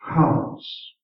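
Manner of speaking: ordinary talk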